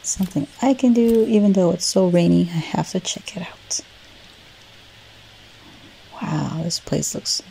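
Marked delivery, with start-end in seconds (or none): 2.4-7.4 soft whisper, words fading away; whispered eagerness. Acted. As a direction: hush it to yourself in excitement. Eager to try the telescope.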